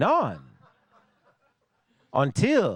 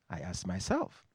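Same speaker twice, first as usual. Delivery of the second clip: ordinary delivery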